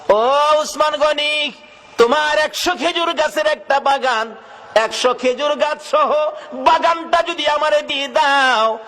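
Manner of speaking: impassioned preaching. Acted. The seller asking Usman for his orchard, impassioned preaching. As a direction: declaim the demand in high, stretched phrases.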